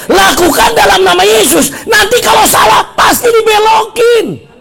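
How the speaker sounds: high, shouted assurance to the congregation; full-force preaching. Acted. Preacher telling congregation God will redirect mistakes, full-force preaching. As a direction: drive the promise home at high pitch.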